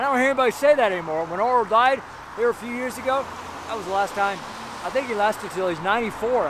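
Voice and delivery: ordinary talk, nothing marked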